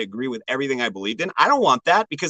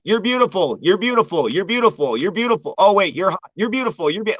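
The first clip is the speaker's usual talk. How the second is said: higher-pitched identical tune on each "You're beautiful"; mock repetitive praise; 6 semitones higher than usual. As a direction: parrot the compliment over and over with the same lilt. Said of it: "just writing the same comment".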